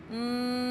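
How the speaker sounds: soft steady hum through closed lips; a quiet closed-mouth buzz; humming bee sound. Shown as a demonstration. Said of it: "we'll make the sound of a humming bee".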